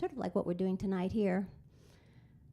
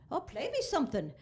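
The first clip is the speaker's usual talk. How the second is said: higher, lilting Irish cop voice; Irish policeman; 7 semitones higher than usual. Retold or imitated. Irish policeman asks the pianist for a tune, eager lilt. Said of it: "up comes the Irish policeman".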